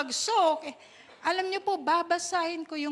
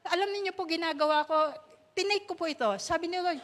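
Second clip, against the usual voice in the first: usual speaking voice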